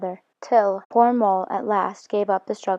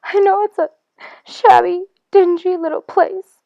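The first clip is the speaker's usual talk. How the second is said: much higher, louder, broken with sobs; sobbing brokenly; 11 semitones higher, 6 dB louder than usual. Acted. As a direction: choke the words out through tears, slowing on the end. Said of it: "he sobbed forth at last brokenly".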